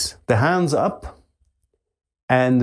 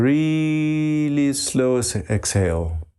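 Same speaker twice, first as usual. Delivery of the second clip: "really" stretched very long, raised pitch; drawn out; 3 semitones higher, slower than usual. Shown as a demonstration. Teacher showing meditators a long breath out, drawn out. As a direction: draw out "really" to show the slowness. Shows how slow the exhale is.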